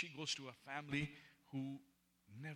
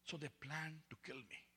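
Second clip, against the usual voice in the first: ordinary talk, nothing marked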